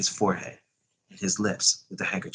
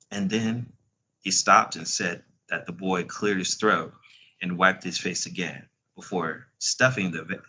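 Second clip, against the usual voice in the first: plain reading voice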